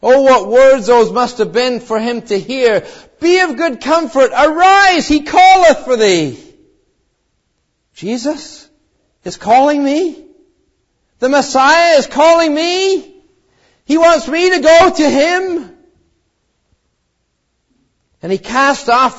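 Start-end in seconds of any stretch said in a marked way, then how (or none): 3.2-6.4 high, loud, bright cry of good news; joyful call. A narrator's reading. A bystander calling joyfully to the blind man. 8.0-15.8 high and loud with wonder; rising joy. A narrator's reading. Blind beggar realizing Jesus is calling him.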